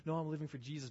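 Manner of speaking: churchy public claim. Acted. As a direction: put on the Christianese for others. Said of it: "You might have the Christianese".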